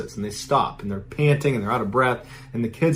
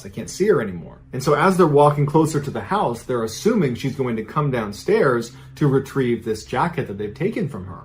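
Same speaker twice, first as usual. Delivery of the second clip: ordinary delivery, nothing marked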